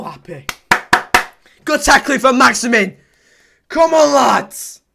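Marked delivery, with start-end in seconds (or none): 1.6-4.8 much higher, much louder rallying shout; excited cheering. Real, not acted. Fan roaring his team on, loud cheer. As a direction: roar the team on.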